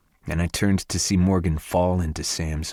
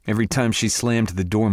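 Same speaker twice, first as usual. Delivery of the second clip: plain reading voice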